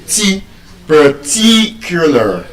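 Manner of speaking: very slow "particular", TI held long; stretched stress on the TI of "particular"; stress on second syllable. Shown as a demonstration. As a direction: isolate TI, then say "particular" leaning on it. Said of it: "I'm stressing what syllable? The second syllable".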